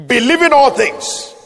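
loud, high preaching punch; emphatic declaration. Real, not acted. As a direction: hammer the phrase home to the congregation. Preacher hammers home quoted phrase.

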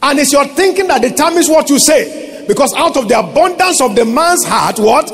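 high, forceful preaching. Acted.

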